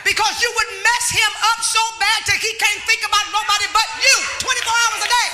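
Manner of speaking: loud, driving preaching; full preaching. Acted. Preacher urging married women, loud driving preaching. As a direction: press the point to the women.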